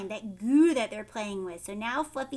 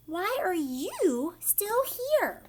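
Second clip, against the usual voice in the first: slow, high, puzzled question; drawn-out wondering teacher voice; surprised teacher; 6 semitones higher, much slower than usual. Acted. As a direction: ask it slowly, surprised to find him there. Teacher surprised to find Fluffy.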